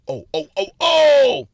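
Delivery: loud rising moaning oh; mock orgasm yell. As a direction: build to a loud high oh. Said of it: "as he yells".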